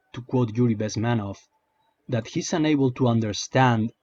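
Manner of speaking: ordinary talk, nothing marked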